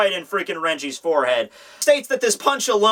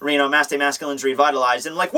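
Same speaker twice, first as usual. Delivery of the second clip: normal talking voice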